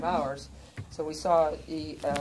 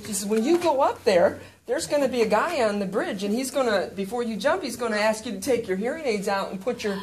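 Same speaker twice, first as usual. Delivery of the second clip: put-on higher, earnest young clerk; deadpan helpful explanation; all serious; 6 semitones higher, 7 dB louder than usual. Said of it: "like, all serious".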